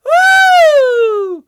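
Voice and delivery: high, loud hammed-up whooping and boasting; sarcastic over-the-top cheerleader; mock rally cheering. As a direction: yell it like a sneering parody of the crowd.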